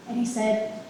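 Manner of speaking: ordinary delivery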